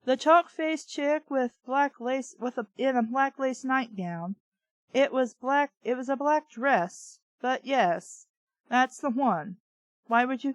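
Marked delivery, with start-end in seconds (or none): none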